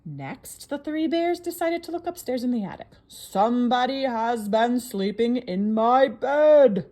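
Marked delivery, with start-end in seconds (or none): 3.1-6.9 loud, very slow gruff papa bear; great gruff voice. Acted. Papa bear finds his bed slept in, great gruff voice. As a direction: boom out each word as a big gruff bear. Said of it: "in his great gruff voice".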